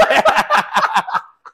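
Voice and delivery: breathy burst of laughter; loud laugh. Real, not acted. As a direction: let out a big laugh.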